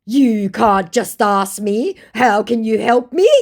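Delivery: slow, loud, high mock indignation; completely indignant. Scammer caught out as not Aussie, hammed-up indignation. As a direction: draw it out in hammed-up affront. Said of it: "completely indignant".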